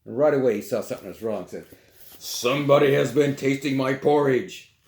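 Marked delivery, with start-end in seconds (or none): 2.2-4.7 lightly voiced papa bear, a bit louder; great big voice. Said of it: "said the great big bear in his great big voice".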